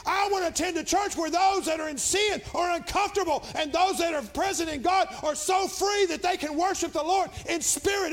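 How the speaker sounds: rising to a loud, high preaching climax; preaching at full force. Acted. Pastor shouting to his Pentecostal church, preaching at full force.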